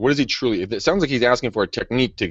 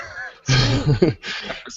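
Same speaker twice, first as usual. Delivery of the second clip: laugh; 4 semitones higher than usual. Real, not acted. Laughs at own joke.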